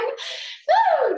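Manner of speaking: high whoop of effort; excited woo. Real, not acted. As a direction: let out a high cheering woo. Cheer near end of set.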